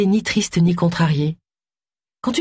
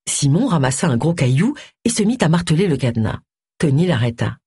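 ordinary delivery, nothing marked

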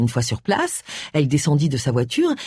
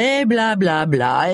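mocking blah-blah; 2 semitones higher, much slower than usual. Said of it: "jouer les indignés".

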